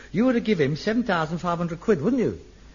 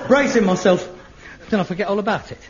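ordinary talk, nothing marked

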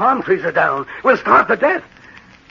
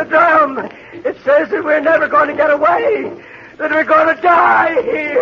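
very high, frantic doom cry; hysterical terror; 10 semitones higher than usual. Acted. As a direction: build to a terrified shout. Maddened man cries out the doom.

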